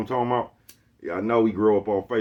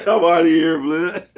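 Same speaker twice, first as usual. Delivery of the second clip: words through a high laugh, louder; 9 semitones higher, 11 dB louder, slower than usual. Real, not acted. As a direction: giggle the exit line. Laughing retelling of quitting.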